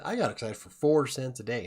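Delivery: usual speaking voice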